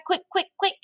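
very high, hurried urging; excited, high-pitched hurry; urgent cry. A narrator's reading. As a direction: urge it on in a high voice. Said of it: "he cried".

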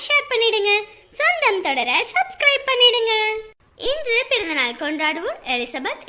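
steady host voice for birthday wishes; vlog outro. Acted. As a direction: read the sign-off to subscribers.

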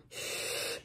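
"sh" as a long, soft hushing sound; drawn-out voiceless "sh"; sound demo. Shown as a demonstration. Teacher repeating the correct S H sound, sound demo. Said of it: "suena sh".

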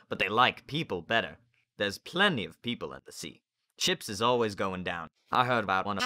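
a hint of a know-it-all boy; doom-loving boy. A narrator's reading. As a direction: chatter on about the danger. Gloomy boy figure holds forth.

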